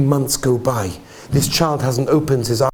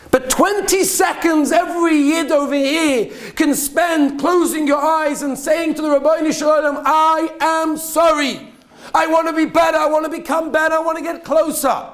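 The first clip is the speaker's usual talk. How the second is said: fiery preaching; 11 semitones higher than usual. Acted. Rabbi urging congregation before Rosh Hashanah, impassioned preaching. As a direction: preach it out to the whole room at full force.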